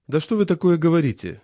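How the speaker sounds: ordinary delivery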